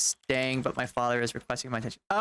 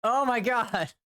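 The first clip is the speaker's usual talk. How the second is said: exasperated; 7 semitones higher, slower than usual. Real, not acted. Raging at the game.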